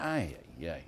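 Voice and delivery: low, soft "ay, ay, ay" of disbelief; exasperated groan. Real, not acted. Host groaning at the guest over Obama's flat speech, exasperated. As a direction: sigh out a weary ay-ay-ay.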